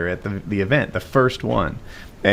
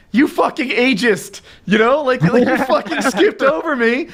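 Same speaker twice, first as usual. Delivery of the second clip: much higher, louder indignant rant through laughter; laughing mock rage; 14 semitones higher, 4 dB louder than usual. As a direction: rant at the line staff, laughing at yourself. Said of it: "And it's like".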